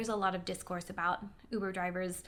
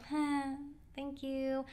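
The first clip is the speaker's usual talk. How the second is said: slow, soft awkward little thanks; a polite, uneasy half-laughed thank you; uncomfortable smile; 5 semitones higher, 5 dB quieter, slower than usual. Retold or imitated. Young passenger politely enduring an old driver's compliments, uncomfortable. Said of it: "uncomfortable smile of like".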